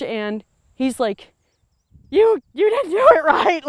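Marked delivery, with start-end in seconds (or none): 2.1-3.6 higher, louder mock scolding; mad. Cameraman scolding her for a bad shot, mock angry. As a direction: imitate an annoyed cameraman. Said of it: "he was all mad".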